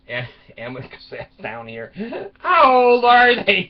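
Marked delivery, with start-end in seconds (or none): 2.4-3.7 loud, high, drawn-out question; mock-appalled wife, slow and high; acted dismay. Wife hearing about two orphan girls, loud dismay.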